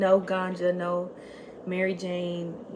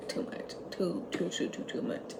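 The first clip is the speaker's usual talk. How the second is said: softer and softer, almost unvoiced; fading to a whisper; 11 dB quieter than usual. Real, not acted. Repeating too much to herself, fading to a whisper. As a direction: let it die away into a whisper.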